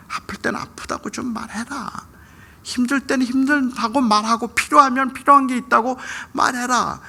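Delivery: high-pitched fatherly plea; urgent reenacted plea, stretched at the end; exasperated pleading. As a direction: beg your son to just speak up. Father pleads with son to speak.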